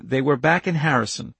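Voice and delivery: plain reading voice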